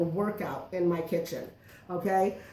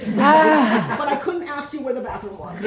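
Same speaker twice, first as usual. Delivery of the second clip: louder, slow, laughing through the line; laughing punchline; 5 semitones higher, 8 dB louder, slower than usual. Real, not acted. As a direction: crack up at her own joke. Punchline about Italian.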